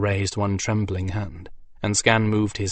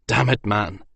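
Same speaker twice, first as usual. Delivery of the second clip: louder rough urging; snarled; 3 semitones higher, 5 dB louder than usual. Acted. Soldier snarling at a grieving friend, louder and rough. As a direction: shake him out of his grief. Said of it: "Victarion snarled at him".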